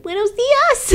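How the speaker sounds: high sing-song greeting; baby greeting. Retold or imitated. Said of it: "we're like".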